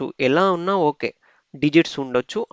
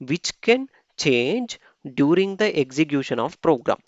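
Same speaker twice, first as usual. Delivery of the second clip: reading rule text aloud very slowly, word by word; slow dictation read; much slower than usual. Acted. Teacher reading the slide rules slowly to students. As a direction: spell it out slowly for the class. Reads the definition.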